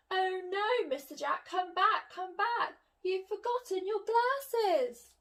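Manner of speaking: high, urgent calling after him. Acted. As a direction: call him back in alarm. Miss Jones calling after him.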